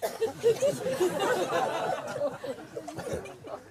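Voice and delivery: real delighted laugh; high burst of laughter. Real, not acted. Teacher laughing at the story's twist. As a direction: laugh at your own joke.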